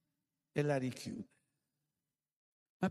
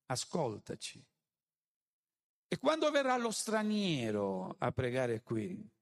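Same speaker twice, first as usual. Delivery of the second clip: preghiera predicata; 5 semitones higher than usual. Acted. Preaches Solomon's prayer aloud.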